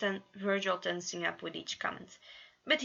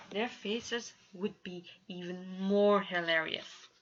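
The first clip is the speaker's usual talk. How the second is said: ordinary delivery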